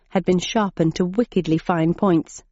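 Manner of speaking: ordinary delivery, nothing marked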